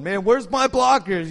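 a player looking around for his blockers; confused quarterback. Retold or imitated. A quarterback on the field wonders aloud, high and frantic. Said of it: "you're going".

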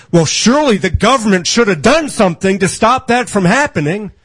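high, mocking preacher impression; sneering parody of a misreader; mocking a naive reader. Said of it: "and he says".

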